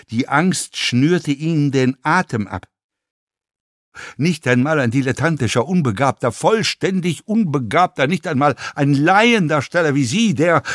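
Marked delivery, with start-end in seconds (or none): 4.1-10.6 insistent tirade, hammering each insult; contemptuous fellow passenger. A narrator's reading. Passenger tears apart actor's talent, contemptuous rant. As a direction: pile up the insults, each more emphatic.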